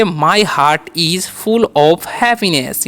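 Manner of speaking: "My heart is full of happiness" stretched with stress on full; very slow model. Shown as a demonstration. Teacher modelling an English sentence very slowly. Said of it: "अब sentence बनाएंगे".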